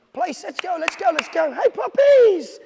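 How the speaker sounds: high, loud, building excitement; excited. Shown as a demonstration. Trainer shows owners the happy command, building high and loud. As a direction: hype the dog up, building to a squeal. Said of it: "it's gotta be".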